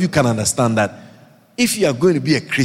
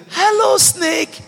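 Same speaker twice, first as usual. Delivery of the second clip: very high, sing-song calling voice, shouted in mock sweetness; coaxing a snake; slower than usual. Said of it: "Nobody sees a snake and says".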